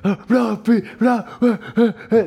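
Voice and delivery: high, loud fan shouting with laughter; crowd yelling. Retold or imitated. Fans at the cutre festival yelling for Brad Pitt, loud and high. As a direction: scream his name like a crazed fan. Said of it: "A voces".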